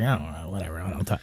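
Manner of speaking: low, very soft brush-off; dismissive mumble. Retold or imitated. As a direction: imitate an indifferent mumble. Imitates daughter's former indifference.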